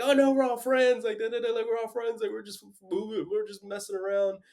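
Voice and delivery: high-pitched innocent act for the cops; mock placating. Drunk man assures the cops they are friends, mock placating.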